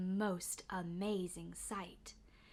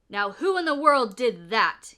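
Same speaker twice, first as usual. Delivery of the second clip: much louder demanding call; loud exclamation; 12 dB louder than usual. A narrator's reading. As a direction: watchman calls out into the dark. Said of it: "he exclaimed".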